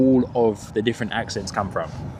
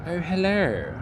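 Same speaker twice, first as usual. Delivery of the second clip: soft, higher-pitched posh English greeting; hushed, high posh English hello; posh English accent; 5 semitones higher, 7 dB quieter, slower than usual. Acted. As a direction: put on a very posh, well-to-do English voice. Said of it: "You know, very posh".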